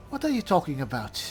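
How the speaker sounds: ordinary delivery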